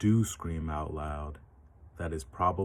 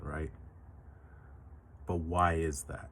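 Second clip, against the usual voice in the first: usual speaking voice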